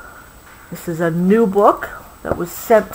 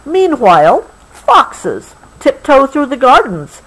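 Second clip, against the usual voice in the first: higher, sneaky storybook reading; story reading; 6 semitones higher, 3 dB louder than usual. A narrator's reading. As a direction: tiptoe the foxes in for the child. Reading book text.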